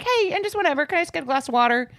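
high, quick, easygoing request; breezy casual ask. Shown as a demonstration. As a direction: toss it off lightly like no big deal. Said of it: "a good to be like".

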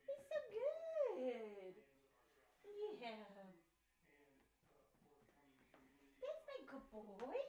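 real tender cooing, far from the mic; pet talk. Real, not acted. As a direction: coo sweetly to a beloved pet. Talking to pet at the door.

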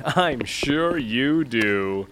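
drawn out, very slow and dismissive; slow, lofty brush-off; slow dismissive repeat. Acted. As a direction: stretch it out with disdain. Snubbing the lackey, drawn out.

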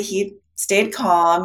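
ordinary talk, nothing marked

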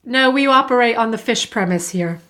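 ordinary delivery

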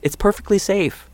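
high-pitched insistence; protesting. A narrator's reading. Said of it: "Maddox protested".

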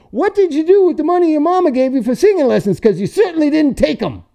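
high, fast mocking old guy voice; high, fast taunting old-timer; old clerk's taunt. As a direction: needle the kid like a crusty old clerk.

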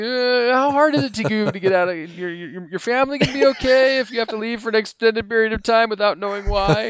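high, strained, hesitant fishing question; awkward feeler. Retold or imitated. Friend hinting at a secret trip, high and halting. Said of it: "It's like".

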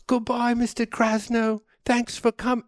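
high sing-out farewell, imitating the staff; imitated office workers seeing someone off; waving well-wisher. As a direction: call goodbye in a put-on high voice. Imitating DMV staff waving goodbye.

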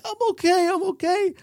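high-pitched imitation of a crying woman; acted tearful. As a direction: put on an upset, tearful reassurance. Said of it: "she just starts crying so badly".

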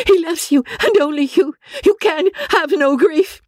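very high, frantic protest; wild cry. Acted. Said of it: "cried Marianne wildly".

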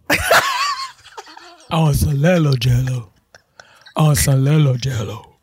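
"Lelo" with L for the Y of yellow, low kid impression; low-pitched imitation, saying "Lelo" for yellow; brother's speech impediment. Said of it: "He struggled with Y".

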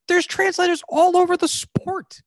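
high-pitched incredulous protest; exasperated disbelief. Real, not acted. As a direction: pitch way up, genuinely baffled. Exasperated at the executive's prejudice.

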